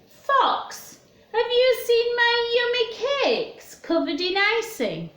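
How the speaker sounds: high, anxious bear calling to his friend; worried bear. Acted. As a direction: ask in a high, fretting bear voice. Bear asks Fox.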